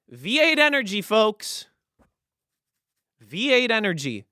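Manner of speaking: belted high and loud like a hype announcer; mock announcer shout. Mock sponsor reveal to viewers, announcer shout. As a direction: sell the brand name at full volume.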